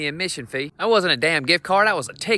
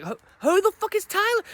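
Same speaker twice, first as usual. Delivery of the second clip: squeaky high outraged disbelief. Retold or imitated. Comic quoting his own stunned question about Tyler, squeaky high. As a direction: shoot way up in pitch with disbelief.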